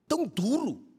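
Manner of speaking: high, pleading complaint to the Spirit; mock grievance, voice lifted high; complaining prayer. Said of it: "falando assim".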